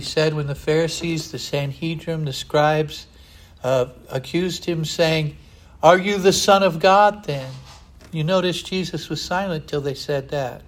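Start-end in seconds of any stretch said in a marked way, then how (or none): 5.8-7.5 loud, higher demanding question; mock accuser's shout; accusing shout. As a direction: hurl the accusation at him. Accusers questioning Jesus, retold.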